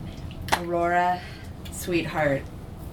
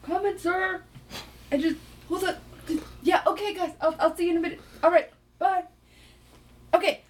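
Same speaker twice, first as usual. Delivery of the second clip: put-on much higher, hurried young man; high young guy voice; 13 semitones higher, 3 dB louder than usual. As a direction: rush off in a high voice. Chad called over by the admiral.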